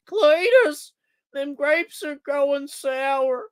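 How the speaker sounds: very high, drawn-out cartoon American accent. Shown as a demonstration. Streamer does American accents on request, high drawling caricature. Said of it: "do more American accents".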